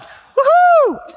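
very high, loud woohoo; wild celebratory whoop; excited cheer. Retold or imitated. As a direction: cheer like a fired-up believer. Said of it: "they're like".

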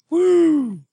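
high whooping cheer; loud excited whoop. Acted. Cheering for unspecified town.

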